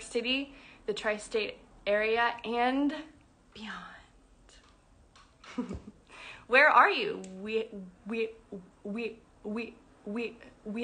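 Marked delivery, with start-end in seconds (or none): none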